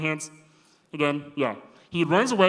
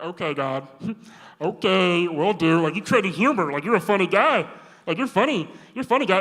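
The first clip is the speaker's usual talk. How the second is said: rising, louder mock laugh at God; sarcastic disbelief; 3 semitones higher, 6 dB louder than usual. Preacher voicing Moses scoffing at God, half laughing. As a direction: scoff like it's a joke you can't believe. Moses mocking God's request.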